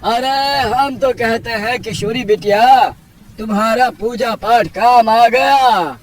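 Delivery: high, emphatic uncle voice; village elder marvelling. Acted. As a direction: declare a divine revelation to the village.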